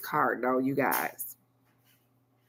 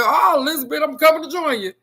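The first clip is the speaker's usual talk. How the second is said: lightly voiced old man clutching his heart, higher and louder; a playful hint of a sitcom dad's fake heart attack; mock dramatic old-man wail; Fred Sanford; 8 semitones higher, 5 dB louder, faster than usual. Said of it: "I feel like damn Fred Sanford".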